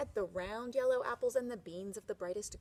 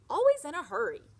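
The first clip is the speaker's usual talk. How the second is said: loud, higher grumble; grumpy complaint; 6 semitones higher, 12 dB louder, faster than usual. Acted. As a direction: complain loudly about the impatient girl.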